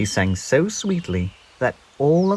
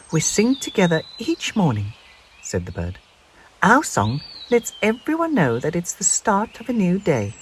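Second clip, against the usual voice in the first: friendly bird voice; 8 semitones higher than usual. A narrator's reading. Bird explains.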